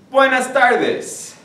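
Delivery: "Buenas tardes" sung out high and slow, BWAY-nas TAR-des; Spanish greeting demo. Shown as a demonstration. Teacher greeting the class in the afternoon, high and slow. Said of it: "You say".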